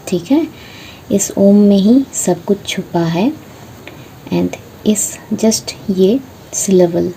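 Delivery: ordinary talk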